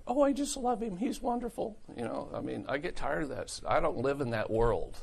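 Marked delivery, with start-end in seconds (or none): 0.1-1.8 very high, mock-adoring falsetto; gushing church sister. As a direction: coo it like a smitten believer, hammed up.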